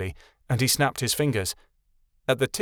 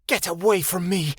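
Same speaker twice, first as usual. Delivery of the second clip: mild snarl; 7 semitones higher than usual. A narrator's reading. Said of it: "she snarled".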